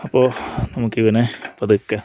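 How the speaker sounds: ordinary talk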